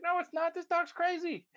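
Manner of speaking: high, loud mock protest; exasperated protest. Client protesting to passersby, high loud mock exasperation. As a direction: squeal it out as if appalled. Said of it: "She's like".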